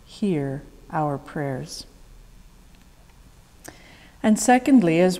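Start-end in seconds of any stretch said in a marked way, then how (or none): 0.1-1.9 prayer response. Real, not acted. Liturgical call in congregational prayer.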